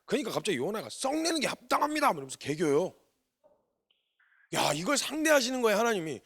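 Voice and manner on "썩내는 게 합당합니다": high, cheeky talking-back voice; defiant Jonah. Retold or imitated. Jonah talking back to God, high and defiant. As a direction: sass God like a sulking prophet.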